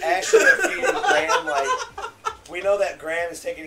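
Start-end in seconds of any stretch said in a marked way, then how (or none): 0.0-1.7 high, loud words through laughter; laughing. Real, not acted. Friend recalling the scene, high laughing voice.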